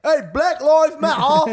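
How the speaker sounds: high, slow cockney character voice; mock British accent. Comic voicing a British gravedigger, high cockney accent. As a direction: play a lowly British gravedigger. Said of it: "one of my favorite British characters".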